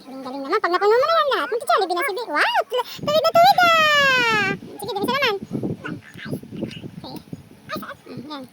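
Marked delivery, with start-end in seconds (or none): 2.3-4.5 loud, high cheer, last words drawn out; real burst of delighted praise; excited praise. Real, not acted. Parent cheers child's straight lines.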